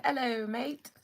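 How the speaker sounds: put-on playful stab at an Australian accent; put-on Australian greeting. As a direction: greet them like a would-be Aussie. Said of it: "I think that was Australian".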